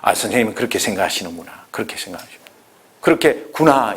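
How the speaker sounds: tender listening reply; gentle sympathy. Shown as a demonstration. Models empathetic reply again.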